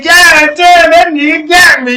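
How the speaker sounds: high, loud mock exclamation; retold alarm. Retells panic at drawn gun.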